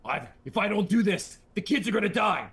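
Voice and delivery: high, loud mock-heroic urgency; hammed-up desperate hero. Movie hero insisting the kids depend on him, mock-desperate. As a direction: plead as an overwrought action hero.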